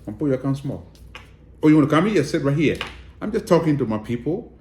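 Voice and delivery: normal talking voice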